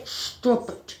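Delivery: hissed, breathy stop it under the breath; fed-up owner whispering at her whining dog; whispered scolding. Real, not acted. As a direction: tell the dog off without raising your voice. Hushed command to dog.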